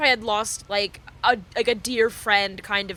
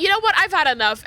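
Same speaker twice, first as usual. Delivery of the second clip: higher, louder done-with-it declaration; fed up; 7 semitones higher, 4 dB louder, much faster than usual. Retold or imitated. Deciding to commit to the crying bit, mock fed-up. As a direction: announce you have had enough. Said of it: "I was like".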